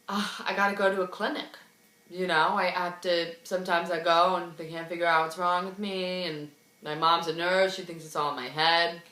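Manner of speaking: low, soft imitation of his explanation; subdued excuse-making. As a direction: explain it quietly and dejectedly, as him. Acted mumbled excuses by Brad.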